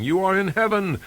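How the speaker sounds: high, loud, chanting announcement; broadcast propaganda message. A narrator's reading. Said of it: "beaming a gigantic electronic message at the inmates".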